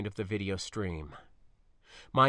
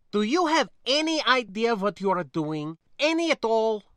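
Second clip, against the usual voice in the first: higher, louder, incredulous questioning; exasperated challenge; 9 semitones higher, 6 dB louder than usual. Acted. Niece challenging spacer's risky plan, exasperated. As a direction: demand an answer in exasperation.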